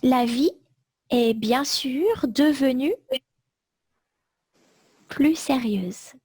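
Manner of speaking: chunks repeated, punctuation read out; slow dictation. Shown as a demonstration. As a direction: dictate it slowly for note-taking. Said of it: "Je répète tout le texte".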